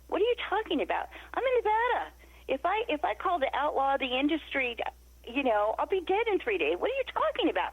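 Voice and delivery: high-pitched, incredulous protest; outraged disbelief. Retold or imitated. Refusing Melissa's demand, outraged.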